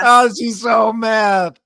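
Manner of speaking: high, slow mocking whine; mock her rage in a high wail; mock mad voice. Mocking the rival to the co-host, in a high whining voice.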